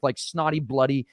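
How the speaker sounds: ordinary talk, nothing marked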